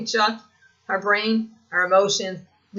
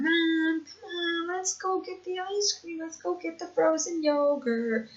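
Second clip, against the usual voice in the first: put-on high, pleading little-kid voice; imitating her daughter; 8 semitones higher, 3 dB quieter, slower than usual. Daughter pulls mom's sleeve wanting frozen yogurt, high pleading voice. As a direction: whine it like a child tugging a sleeve. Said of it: "my daughter is, like, pulling on my sleeve, going".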